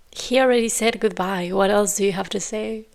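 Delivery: ordinary talk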